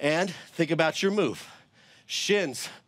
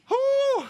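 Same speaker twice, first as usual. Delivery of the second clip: high whew exhale; 3 dB louder than usual. Real, not acted. Exhaling after a hard set.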